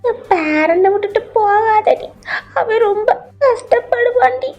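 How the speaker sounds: high, slow tearful pleading; wailing granny plea; crying grandmother. Acted. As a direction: beg her through acted sobs, dragging the words. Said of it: "அழாதீங்க".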